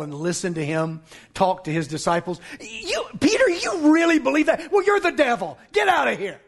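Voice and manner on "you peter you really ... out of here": high, incredulous scolding that settles as it goes; exasperated rebuke. Acted. Jesus rebuking Peter as the preacher imagines it, exasperated. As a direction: tell him off in disbelief.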